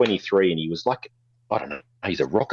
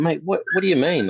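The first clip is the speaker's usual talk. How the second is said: high, baffled protest; mock-baffled mate; incredulous; 10 semitones higher than usual. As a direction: act genuinely thrown by the accusation. Retold clash with squat bully.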